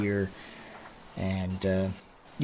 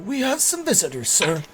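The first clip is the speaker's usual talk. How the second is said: high-pitched servant's voice; Corwin character voice; 11 semitones higher than usual. Acted. Corwin announces visitors to his master, high-pitched servant voice.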